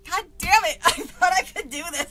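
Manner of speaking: loud, high frustrated cry; real exasperation breaking into a laugh; exasperated outburst. Real, not acted. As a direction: blurt it out, fed up with yourself. Frustrated at failing the accent.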